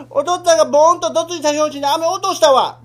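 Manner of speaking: put-on loud childish complaint; child wailing. Boy complaining loudly to father about dropped candy. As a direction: whine it loud like a crying boy.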